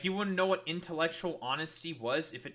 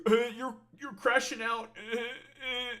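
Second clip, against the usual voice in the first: high, loud mocking voice of a chatter; nasal-free sarcastic mimic of the accuser, higher; mocking whiner; 8 semitones higher, 4 dB louder than usual. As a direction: sneer it back like a whiny viewer.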